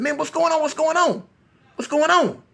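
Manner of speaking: high-pitched alarmed questioning; mock hollering. People running out front after gunshots, alarmed hollering. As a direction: call it out high like a panicked bystander. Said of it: "people start hollering".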